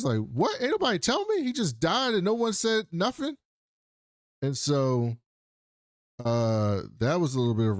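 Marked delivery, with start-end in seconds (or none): 0.2-3.4 much higher, hammed-up shock; shocked disbelief. [name] learning his dad died, mock shock pitched way up. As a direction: yelp it out in disbelief.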